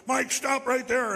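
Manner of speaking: loud, high imitation of a cop's command; shouted police order. Town cop yelling after a fleeing man, imitated shout. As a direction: call it out slowly, like a cop halting a runner.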